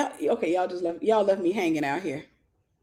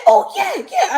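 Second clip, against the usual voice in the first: shrieking high, loud yeah; excited yes; 9 dB louder, slower than usual. Retold or imitated. Sister realizing the guy repeats her, shrieking yes. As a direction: squeal it out in excitement. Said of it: "She said".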